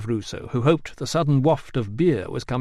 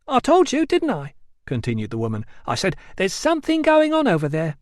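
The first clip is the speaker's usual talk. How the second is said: higher, scolding local woman; higher chiding wife voice; barber's wife; 7 semitones higher, 3 dB louder than usual. A narrator's reading. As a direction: stick up for the dead man.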